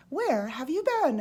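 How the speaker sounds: put-on excited fish greeting an old friend. A narrator's reading. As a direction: burst out with delight. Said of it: "fish excitedly".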